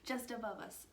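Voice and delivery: usual speaking voice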